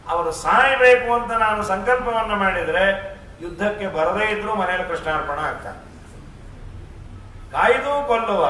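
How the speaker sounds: ordinary delivery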